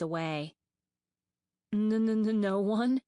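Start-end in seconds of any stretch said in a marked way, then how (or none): 1.7-3.0 stuttering, louder and higher in fear; scared dragonet stammering to the queen; frightened stammer. A narrator's reading. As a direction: trip over the first sound in terror. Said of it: "Starflight stammered".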